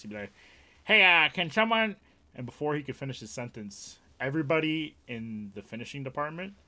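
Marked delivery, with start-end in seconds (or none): 0.8-2.0 very high, loud imitation of a rushed manager; stressed boss. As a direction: mimic a stressed boss calling for help. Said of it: "he was very stressed".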